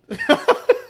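high-pitched burst of laughter. Real, not acted. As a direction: squeal with laughter. Laughing hard.

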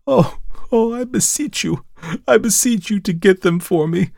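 high, pained begging; pained pleading cry. A narrator's reading. Cousin begs the narrator for money, pained high cry. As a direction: beg as if in pain. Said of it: "She uttered a cry as if she were in pain".

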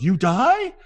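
high, rising shocked question; imitated astonished outburst; loud disbelief. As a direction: blurt the secret out loud. Retold loud blurting of a secret.

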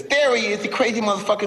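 high squeaky Tyson greeting; light Tyson voice, pleased; Tyson impression. Tyson praises the comedian after show, high squeaky voice. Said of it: "Mike comes up to me and says".

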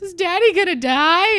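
put-on little girl voice. Youngest fawn asking about her dad, scared child voice. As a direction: ask like a frightened little girl.

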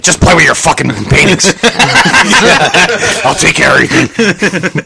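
gruff boss voice, breaking into laughter; Cheney impression, laughing. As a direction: growl it out, then crack up laughing. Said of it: "Cheney was like".

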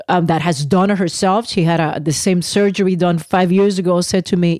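normal talking voice

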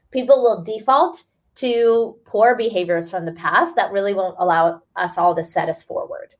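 ordinary delivery

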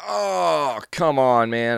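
high, loud come on, man; mock exasperation. Host reenacting the Walgreens cash-back refusal, exasperated. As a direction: whine it like a let-down customer.

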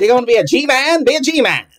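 vintage radio ad pitch; 1950s narrator. Retold or imitated. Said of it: "excuse my nineteen thirties impression voice".

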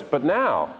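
usual speaking voice

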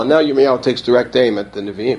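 ordinary talk, nothing marked

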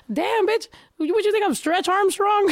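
high, teasing complaint; playful mock exasperation. Real, not acted. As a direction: rib her for putting it so far away. Friend left snacks out of reach.

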